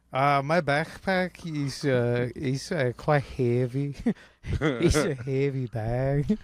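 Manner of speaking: hesitant joking immigrant GI voice; American soldier impression. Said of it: "I'm doing an American soldier right now".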